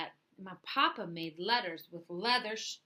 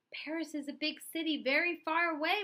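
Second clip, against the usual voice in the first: higher, anxious warning; sisters' warning; 8 semitones higher than usual. Acted. Sisters warning Louis Paris is far, higher anxious voice. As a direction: warn him like a worried sister. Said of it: "my sisters warned".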